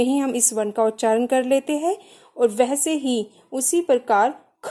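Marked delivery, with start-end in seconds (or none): 4.6-4.7 ख held long with extra air; drawn-out aspirate. Shown as a demonstration. Teacher showing why it is mahapran, ख drawn out long. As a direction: stretch ख out on a long release of breath. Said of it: "खा को हम थोड़ा लंबा खींच रहे हैं".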